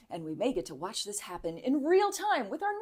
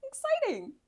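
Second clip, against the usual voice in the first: high sarcastic cheer. Acted.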